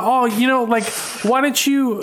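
high, halting attempt at Jamaican; faked Jamaican accent. Nurse starting small talk with patient, faked Jamaican accent. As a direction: tentatively imitate a Jamaican nurse. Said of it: "I'm not good with accents".

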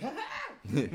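very high squealing mock laugh; imitated laugh. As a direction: imitate a random high cackle. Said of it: "they have random laughs and they're like".

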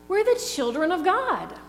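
high, ringing cartoon-hero declaration; lifted up, ringing on the; rousing cartoon rally. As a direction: proclaim it like a brave little hero. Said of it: "this is the line in VeggieTales that got me".

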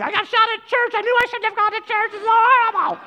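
high, loud whining complaint; hammed-up high wail of complaint; mock whiny victim. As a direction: whine it like someone who got hurt at church. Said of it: "I don't want you to be like".

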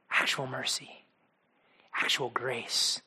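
soft, slow whisper; hushed, slow and tender. Real, not acted. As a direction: breathe the words gently. Tender whispered gospel line.

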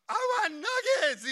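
put-on high, loud, slow whiny toddler voice through a laugh; toddler demanding. Father imitating his toddler son Luke demanding nuggets, high whiny voice. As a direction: play a three-year-old who wants nuggets. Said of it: "to the front seat and goes".

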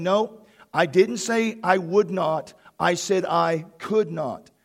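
"would not" against "could not", slow with "could" punched; Jesus emphatically correcting, slow and firm; stress on not. Retold or imitated. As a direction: slowly hit "could" to contrast "would". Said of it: "He was emphatic about it".

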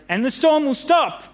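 high-pitched call to the sailors; acted shout. A narrator's reading. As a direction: call it out high, over a storm. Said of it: "he shouted to the sailors".

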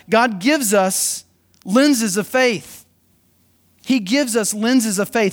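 slow, deliberate weight on gives and lenses; preacher slowing for the key line; slow emphasis. Real, not acted. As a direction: drive home lenses of faith. Slow emphatic sermon point.

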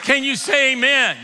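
loud, high, driving sermon climax; preaching at full force. Acted. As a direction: shout it to the congregation.

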